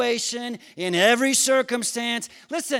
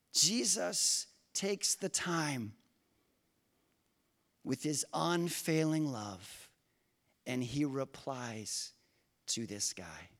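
hushed preaching; 9 semitones lower, 11 dB quieter, slower than usual. Acted. Soft slow preaching aside.

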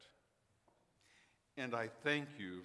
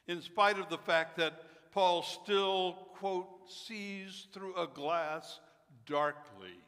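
slow, rising, louder sermon delivery; preaching; 4 semitones higher, 6 dB louder, slower than usual. Acted. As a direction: build the sermon with force. Building sermon climax.

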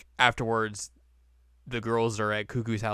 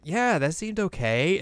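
higher, slow, airy agreeing voice; oblivious agreement; 7 semitones higher, slower than usual. Retold or imitated. Host voicing the clueless girl who signed the contract, high and slow. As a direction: sound naive and unbothered. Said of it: "And Kanan's like".

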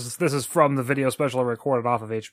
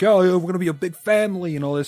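a hint of a cheesy Jersey bro, higher; mocking the new boss; 6 semitones higher, 4 dB louder than usual. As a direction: lightly send up the fake-tan boss. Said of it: "and he's like".

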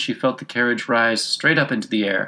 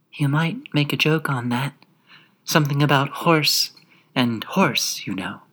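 small, higher-pitched little creature; extremely small voice; 5 semitones higher than usual. A narrator's reading. Tiny gnat at Alice's ear, small high voice. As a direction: shrink the voice, close to the ear. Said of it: "an extremely small voice close to her ear".

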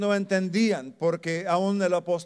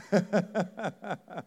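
short laugh; 4 semitones higher than usual. Real, not acted. Laughs about bacon joke.

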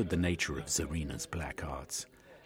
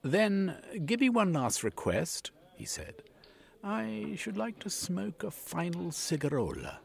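raised, dignified last request; 11 semitones higher than usual. A narrator's reading. Cornucope asks Yegman for a last cigarola, resigned. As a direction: ask with weary dignity.

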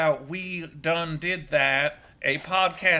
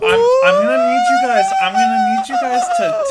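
high mock voice; 8 dB louder than usual.

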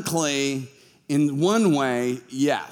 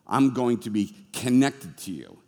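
gentle God voice; 7 semitones lower, 3 dB quieter than usual. Acted. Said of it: "He's like".

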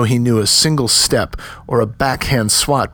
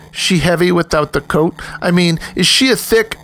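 higher-pitched, goading questions; snickering taunt; 8 semitones higher than usual. A narrator's reading. Pico mocking Aris about his girl, snickering taunt. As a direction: needle him in a raised voice.

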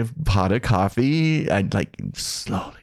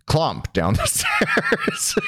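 slow, very high, laughing through the word stairs; laughing mock clomp; much slower than usual. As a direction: drag it out laughing at the noise. Mocking noisy roommate.

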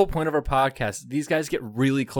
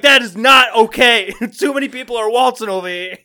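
mock scolding shout; 8 semitones higher, 10 dB louder than usual. Cohost voicing outraged locals, shouting. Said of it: "They're like".

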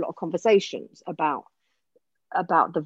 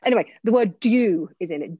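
normal talking voice

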